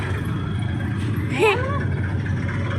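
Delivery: high, airy laugh; breathy laugh. Real, not acted. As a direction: laugh it out unvoiced. Laughs at the hard climb.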